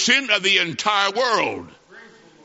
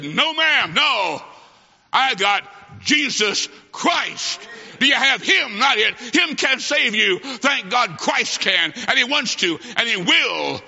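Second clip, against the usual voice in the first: preaching at full force; 5 semitones higher, slower than usual. Acted. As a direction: build the sermon to a ringing peak. Preacher building to climax.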